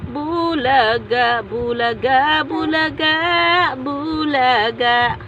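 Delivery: slow, stretched-out singsong syllables; peekaboo. Pretend. Parent playing peekaboo with baby, slow singsong. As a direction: draw out each peekaboo for a baby.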